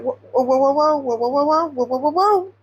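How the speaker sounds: mock-alarmed stop-right-there reaction; alarmed protest. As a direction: keep stacking the whoas, rising as if to halt someone. Acts out alarmed reaction to Aaron.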